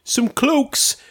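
high, breezy innocent seller; innocent merchant voice. Retold or imitated. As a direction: play it innocent, pitched high. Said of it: "You're like".

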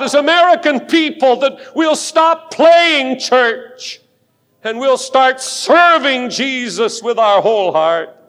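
high, loud impassioned plea to God; full-force preaching prayer. Real, not acted. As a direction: cry out to heaven, stretching key words.

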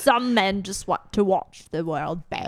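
slow, hammed-up Cockney film line; Michael Caine. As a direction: drag out a mock Cockney quote slowly. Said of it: "Good accent".

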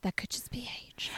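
unvoiced teasing whisper. Real, not acted. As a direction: whisper the jab under your breath. Teasing aside.

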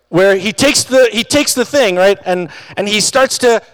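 ordinary talk

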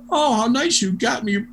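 high-pitched imitation of joking thanks; joking mock delight. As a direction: pretend to be thrilled by the gift. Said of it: "he just jokes all the time".

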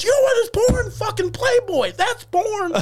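high angry mock dad yell; mad dad.

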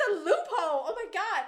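excited. Retold or imitated. Excited reaction retold.

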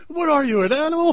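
high, loud mock disbelief; mock outrage. Dreamer recalling being shot, mock outraged disbelief. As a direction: squeal it at the dream shooter.

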